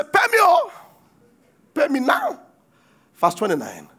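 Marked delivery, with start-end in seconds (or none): 0.0-2.4 demanding shout. Acted. Servant demanding payment from fellow.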